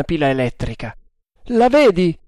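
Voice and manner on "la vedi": high, loud demand; thundered question. A narrator's reading. Demanding news from the lookout, thundering. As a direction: thunder it up the mast. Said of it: "tuonò".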